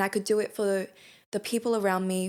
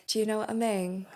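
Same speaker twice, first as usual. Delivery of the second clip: mock English accent; 2 semitones higher than usual. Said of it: "adopting an English accent".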